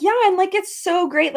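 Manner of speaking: high, loud mock gushing; imitating gushing stranger. Woman bragging about helping new-mom friend, high gushing imitation. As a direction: gush it high, mimicking an overeager acquaintance.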